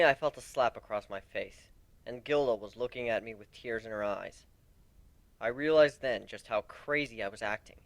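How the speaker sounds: plain reading voice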